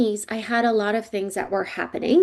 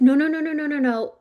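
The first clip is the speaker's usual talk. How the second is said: frightened refusal; 5 semitones higher than usual. Retold or imitated. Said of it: "And I was just like".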